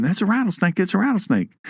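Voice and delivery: high, panicky "rattlesnake!" as a neighbour; mock panicked scream. Neighbours spotting a snake in the road, mock panicked yell. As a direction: imitate frightened neighbours shrieking. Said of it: "there were some people screaming".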